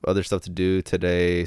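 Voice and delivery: ordinary delivery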